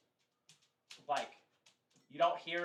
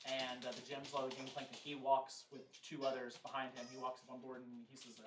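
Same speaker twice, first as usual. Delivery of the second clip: ordinary delivery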